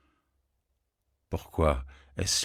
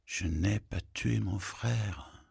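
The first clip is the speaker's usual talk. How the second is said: slow, spoken aloud with tué falling; slow spoken denial; slow voiced denial; 3 semitones higher than usual. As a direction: say it slowly and deliberately aloud. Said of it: "repeats denial in different takes".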